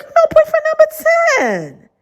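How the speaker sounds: put-on squeaky teasing boyfriend number ten; high mocking voice. God teasing Ronica about her boyfriend count, high and mocking. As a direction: go up high and tease. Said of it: "he was like".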